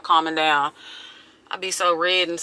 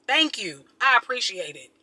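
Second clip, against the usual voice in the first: usual speaking voice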